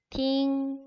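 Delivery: 팅 held high and level; first tone. Shown as a demonstration. Teacher models the word for listening, 팅 in level first tone. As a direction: sustain 팅 on one high, even pitch. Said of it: "일 성으로 팅이라고 발음을".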